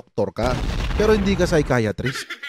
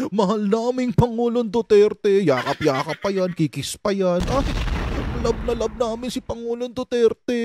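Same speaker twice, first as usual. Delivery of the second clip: Badoy; 4 semitones higher than usual. Devotee professing love for President Duterte, mock gushing. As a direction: gush devotedly in exaggerated imitation. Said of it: "Pero pag si Badoy".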